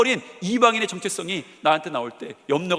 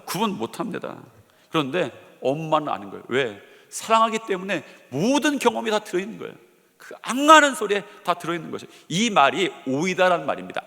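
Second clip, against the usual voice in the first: ordinary delivery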